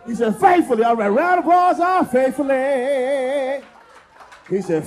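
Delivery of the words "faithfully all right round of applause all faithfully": crowing mock announcement. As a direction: proclaim the answer to the crowd. Crows audience member's answer to crowd.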